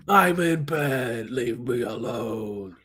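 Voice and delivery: slow, drawn-out plea to be left alone; hammed-up lazy complaint; mock whine. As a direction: drag the words out in mock protest. Answering the imagined yelled text.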